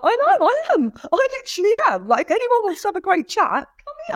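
high-pitched, giddy retold reply; gleeful self-hype. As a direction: gush it way up high, hamming up the happiness. Acting out her happy reply to fans.

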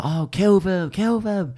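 rising wicked command; witch ordering. Retold or imitated. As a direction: urge him on like the witch. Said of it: "the witch says to him".

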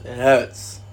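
ordinary talk, nothing marked

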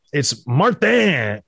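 slow "Martin" in a French accent; slow mock French Martin. Shown as a demonstration. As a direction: say "Martin" as mar-TAN. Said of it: "the French accent on it".

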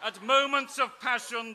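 normal talking voice

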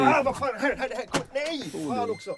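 high-pitched, flustered cursing; exasperated dismay. Real, not acted. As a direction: fuss helplessly over the sick dog. Owner reacts to dog vomiting. (stressad, ljus uppgiven svordom)

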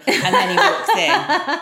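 a laughing, hammed-up American cowboy drawl. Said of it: "like a bit of a cowboy".

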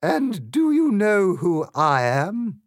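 high, loud, slow regal voice; haughty Queen. A narrator's reading. Queen challenges Alice on her rank, haughty and loud. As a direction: lord it over the girl. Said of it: "said the Queen".

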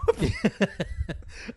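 rising high-pitched laugh. Real, not acted. Laughs before the manga remark.

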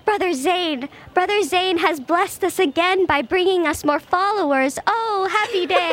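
devout cultist. Acted. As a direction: praise the leader in slow ecstasy. Cultist praises leader.

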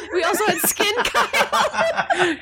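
laughing. Real, not acted. Laughing recap of silly NPC.

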